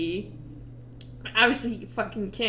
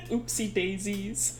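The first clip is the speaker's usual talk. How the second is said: soft, cutesy oopsie daisies; softer, teasing and cute; mock baby talk; 7 dB quieter than usual. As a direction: coo it like a baby's tumble. Mocking Ricky's explosive accident.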